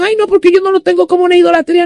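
high, rushed mock protest; mimicked objector. People who love elephants defending them to her, high mimicked protest.

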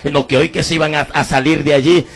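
ordinary delivery

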